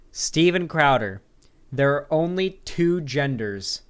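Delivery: loud, drawn-out showman's build-up; slow, emphatic title-card delivery with big pauses; hype announcer. Pretend. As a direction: announce it like a grand throwback event. Hyped segment introduction.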